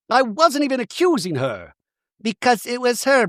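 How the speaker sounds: high, insistent protest; mock indignation. Bronwyn insisting on who leaked, indignant. Acting Bronwyn accusing Lisa.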